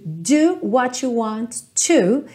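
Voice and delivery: slow, leaning on the final "to"; stress on to. Shown as a demonstration. Teacher stressing the final "to" for students. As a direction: land on "to" at the end. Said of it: "termine nesse to".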